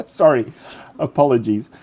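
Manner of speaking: ordinary delivery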